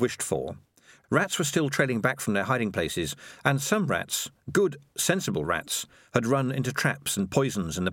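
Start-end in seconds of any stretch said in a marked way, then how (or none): none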